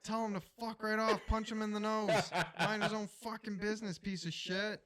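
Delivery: high, loud imitated old neighbor; feisty old guy. Old neighbor backing him against the complainer, mock indignant. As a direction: play an indignant old neighbor sticking up for you. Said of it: "he's just a nice old guy".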